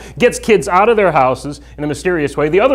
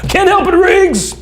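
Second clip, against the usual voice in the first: much higher, drawn-out comic cry; mock movie yell; 3 dB louder, slower than usual. Comedian playing an exasperated cop to his partner, high mock yell. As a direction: yell it slowly like an action-film catchphrase. Acted movie catchphrase after joke.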